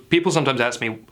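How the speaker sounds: ordinary delivery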